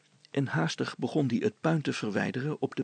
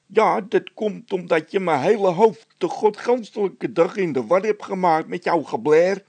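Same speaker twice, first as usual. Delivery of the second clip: high, loud angry blaming; a worker blaming his partner's singing; angry blame; 6 semitones higher, 6 dB louder than usual. Acted. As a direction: throw the blame back hard. Quarrel continues, blaming back.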